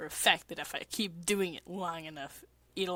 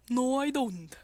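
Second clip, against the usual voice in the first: put-on a bit higher, offhand boy voice; lightly voiced Pico; 5 semitones higher than usual. Pico denying he cares, lightly voiced. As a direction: deny it like a bored Vocaloid. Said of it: "This is a voice acting stream".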